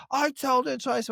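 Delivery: high whiny mock complaint; mock angry bloke. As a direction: play the sulky customer. Angry customer voiced in story.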